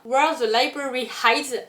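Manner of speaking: self-mocking posh English accent on the title; proud mock English accent. Shown as a demonstration. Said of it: "avec mon super accent anglais".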